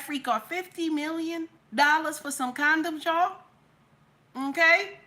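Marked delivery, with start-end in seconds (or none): none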